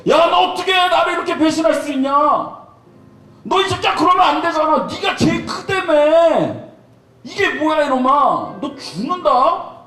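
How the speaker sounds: high shouted scolding, slowing at the end; imagined angry rebuke. Retold or imitated. Jesus scolding traitorous Peter, high and shouted. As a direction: chew him out, furious and mock-threatening. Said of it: "막 얘기하고 싶었을 것 같은데".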